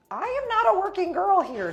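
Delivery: high, loud outraged protest; indignant shout. Retold or imitated. To the gangster grabbing her arm, a high indignant yell. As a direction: shake him off, offended and loud.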